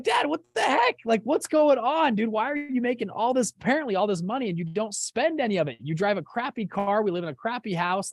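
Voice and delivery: retold exasperated yelling, easing off; exasperated outburst at dad. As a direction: replay your disbelief at dad, building down to grumbling.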